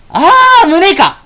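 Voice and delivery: a loud, high "ah, the chest!"; loud exclamation. Acted. Fairy loudly blurting it out in the street, loud exclamation. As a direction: announce it loudly without thinking. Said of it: "大きな声出しな。".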